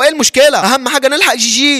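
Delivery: high, agitated argument; urgent insistence. Retold or imitated. Friend insisting they save the girl, urgent.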